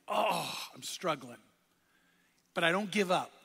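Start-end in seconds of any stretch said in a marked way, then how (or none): 0.1-1.4 weary admission. Retold or imitated. Preacher voices his own doubt.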